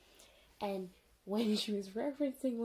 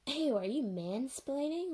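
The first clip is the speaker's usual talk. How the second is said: higher, louder, drawn-out retort; mock disgust; 6 semitones higher, 5 dB louder than usual. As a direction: act out an offended comeback, slowly. Retold disdainful question from Bloom.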